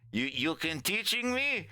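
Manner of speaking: put-on high, accented broken English; Leon. Knife-holder poking the captive, high accented challenge. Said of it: "Leon pokes him in the neck".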